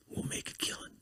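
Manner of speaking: very soft, whispered romance-narrator tease; hushed, voiceless whisper; whispered sales pitch. Acted. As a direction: whisper it like a secret scheme.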